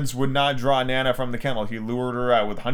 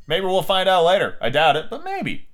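ordinary delivery

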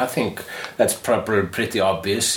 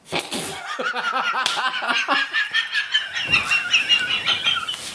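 laughter. Real, not acted. Bursts out laughing at joke.

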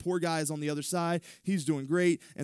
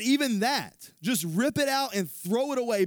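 forceful; 8 semitones higher, 5 dB louder than usual. Acted. Quoting Jesus with force.

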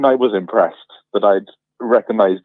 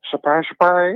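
higher, put-on catchphrase voice; 7 semitones higher than usual. Teasing the host about a breakfast show, celebrity catchphrase impression. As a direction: do the presenter's catchphrase in imitation.

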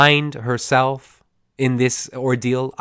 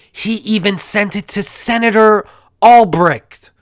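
louder, higher put-on boss; 8 semitones higher, 6 dB louder than usual. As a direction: play the scandalised boss. Boss voice in retold scene.

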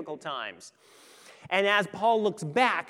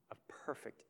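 a hushed whisper for emphasis; 5 semitones lower, 16 dB quieter than usual. Acted. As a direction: drop the words to a secret. Preacher drops to intimate whisper.

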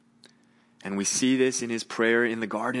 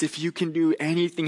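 higher and quicker, pleading; desperate father; 7 semitones higher, 3 dB louder, faster than usual. Retold or imitated. Father begging Jesus for his son, quick high plea. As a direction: rush the plea out, a touch higher. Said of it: "this father says to Jesus".

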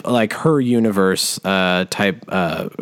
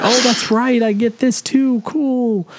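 much higher, pleasantly surprised voice; delighted surprise; 13 semitones higher, slower than usual. Pretend. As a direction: act out happily discovering a gift. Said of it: "I'll be like".

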